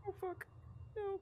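high, panicked string of no's; terrified, breathless pleading; being chased. Real, not acted.

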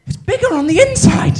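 high, loud, awestruck delivery; classic tardis line. Pretend. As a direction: deliver the famous line in full wonder. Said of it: "And I fucking nailed that".

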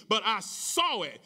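ordinary delivery

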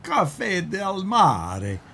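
slow Italian accent on Cafe del Mare. Shown as a demonstration. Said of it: "I say it with my Italian accent".

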